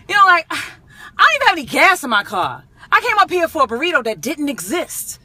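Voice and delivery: high, loud, aggrieved complaint; hammed-up exasperation. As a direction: play up the outrage of a wasted trip. Exasperated complaint over wasted trip.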